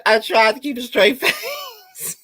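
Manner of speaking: cracking up mid-sentence; real laughter breaking through; laughing. Real, not acted. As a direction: try to talk through the giggles.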